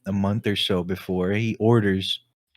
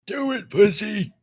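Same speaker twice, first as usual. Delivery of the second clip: much higher, slow cartoon kid taunt; mock cartoon taunt; 12 semitones higher than usual. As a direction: goad him like a cartoon brat.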